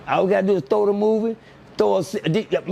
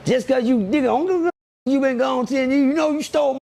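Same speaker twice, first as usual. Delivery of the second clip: mock angry confrontation; 8 semitones higher than usual. To a cousin back after years, high mock yell. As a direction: play the aunt yelling at the cousin who stole.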